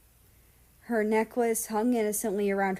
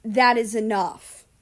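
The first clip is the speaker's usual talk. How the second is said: stern; 2 semitones higher, 8 dB louder than usual. Acted.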